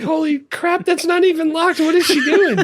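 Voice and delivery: high, laughing, incredulous outburst; laughing disbelief. Retold or imitated. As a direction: blurt it in amused shock. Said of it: "I was like".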